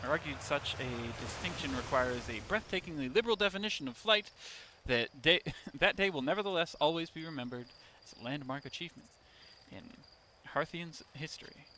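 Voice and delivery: a light mock museum narrator; museum plaque reading. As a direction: read it like an exhibit sign. Reads a museum plaque aloud.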